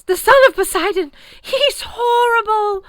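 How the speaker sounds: crying out in terror; terrified scream. A narrator's reading. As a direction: scream in horror. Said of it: "terrified noise".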